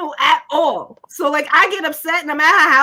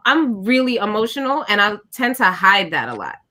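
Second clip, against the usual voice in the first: ordinary talk, nothing marked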